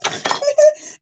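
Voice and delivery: high, shrieking laugh; helpless laughter. Real, not acted. Streamer whose shaker broke mid-celebration, helpless laughter.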